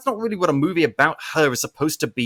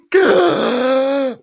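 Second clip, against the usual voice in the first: mock gasp; 8 semitones higher, 5 dB louder than usual. Mock reaction to reused twist.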